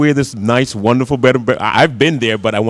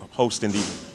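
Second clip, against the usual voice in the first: normal talking voice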